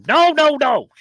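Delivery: high, loud string of refusals; Joe Pesci. Retold or imitated. Star interrupting the comic's apology, high and loud impression. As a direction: cut in with a high-pitched, exasperated mock protest. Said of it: "And Pesci's like".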